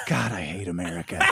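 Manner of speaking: low, quiet mock villain grumble; muttering terrorist. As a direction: growl it low under your breath like a movie villain. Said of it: "And then he goes".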